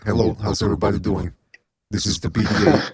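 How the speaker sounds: low, menacing voice through heavy distortion; distorted menacing voice. Shown as a demonstration. Host greeting the stream in a distorted villain voice. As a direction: speak like a masked villain on a warped line. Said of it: "I'm trying to play with some sound effects here".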